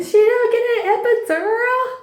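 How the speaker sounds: high, loud scream of protest; screaming mom. Retold or imitated. Mom yelling at the nurse about the epidural, screaming. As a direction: shriek it like a panicked mother. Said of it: "And my mom's screaming".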